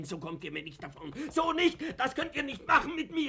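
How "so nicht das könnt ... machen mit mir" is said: high, indignant outcry; outraged protest. Acted. Fuchs protests to the doctor and the director, high and outraged. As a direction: refuse it with outrage.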